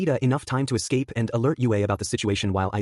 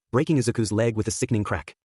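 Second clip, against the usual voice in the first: plain reading voice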